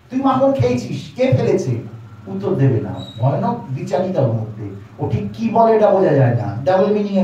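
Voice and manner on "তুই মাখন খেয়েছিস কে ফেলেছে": higher, sharp scolding mother's question; angry mother scolding. Retold or imitated. Mother scolding little Krishna about the butter, angry. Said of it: "মা তখন খুব রেগে গেছে".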